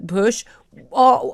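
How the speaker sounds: ordinary talk, nothing marked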